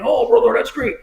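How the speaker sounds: high-pitched wrestler catchphrase voice; Hulk Hogan. Retold or imitated. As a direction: imitate a hearty celebrity wrestler greeting a fan. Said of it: "he talks like he does".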